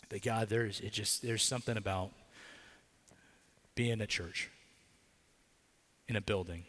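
prayer. Acted. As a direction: pray over the church, unhurried and earnest. Pastor leads the church in prayer.

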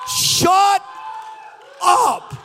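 loud, high shout, a pause between the words; krzyk uciszający. Acted. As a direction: shout down the complainers. Silencing the mocked complainers.

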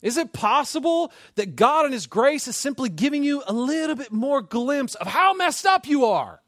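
high, loud preacher's challenge; impassioned preaching. Real, not acted. Preacher challenging the congregation about their brokenness, impassioned. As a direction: press the question home, high and forceful.

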